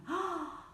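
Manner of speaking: high, sudden gasp; amazed gasp. Acted. Animals look around as gloom lifts, amazed gasp. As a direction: draw breath in wonder.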